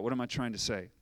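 normal talking voice

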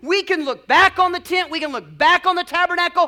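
high, loud, insistent repetition; full-force preaching. Real, not acted. Preacher urging congregation to look back, full-force preaching. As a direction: preach it in driving, building phrases.

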